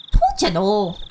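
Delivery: mock Fargo accent, round long o's. Shown as a demonstration. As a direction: imitate an up-north Fargo local. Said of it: "That's another accent".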